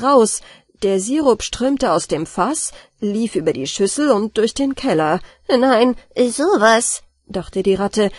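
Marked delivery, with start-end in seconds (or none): 5.5-7.0 dismayed. Acted. Rat sees syrup flood cellar.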